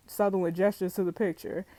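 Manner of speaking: ordinary talk, nothing marked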